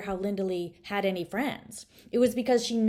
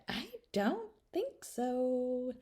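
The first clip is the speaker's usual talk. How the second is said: put-on old grandmother; 7 semitones higher, 4 dB quieter, much slower than usual. A narrator's reading. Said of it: "Nana Gigi shook her head".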